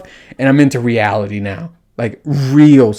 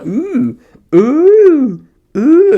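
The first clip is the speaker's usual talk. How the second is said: rising high mock moans, growing louder; louder, higher hammed-up ooh moans; mock moaning; 6 dB louder, much slower than usual. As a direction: ham up the moan, climbing higher. Mimicking the trend moan again.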